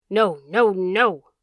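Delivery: loud, drawn-out dog howl; loud, wavering howl; malevolent howl. A narrator's reading. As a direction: howl the refusal right over the plea. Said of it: "threw back at him in a malevolent howl".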